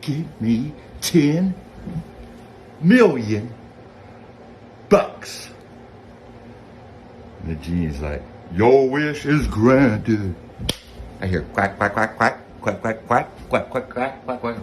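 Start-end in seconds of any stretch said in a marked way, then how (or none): 0.0-5.5 slow wish. Retold or imitated. Making the wish, drawn out. 8.5-10.4 genie. Acted. Genie grants the wish.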